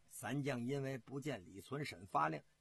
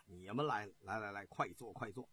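lightly voiced general, low and softening; low-pitched, understated invitation to sit; welcoming general; 6 semitones lower, 4 dB quieter than usual. General greets three officers who found him in the garden, low understated welcome.

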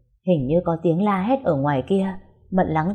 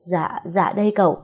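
timid stammer. Acted. Trembling servant hands over cloth.

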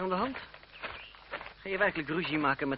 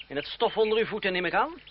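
louder, mocking bow of words; bitter sarcasm; 5 semitones higher, 6 dB louder than usual. André to the lady, loud bitter sarcasm. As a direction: sneer it with mock humility.

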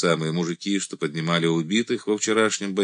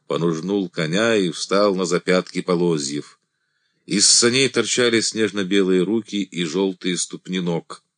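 plain reading voice

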